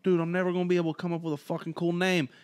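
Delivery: rushed, fast moping; whiny fast complaint. Retold or imitated. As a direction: blurt it out fast and sulky. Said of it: "Violent J was like".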